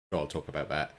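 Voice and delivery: ordinary delivery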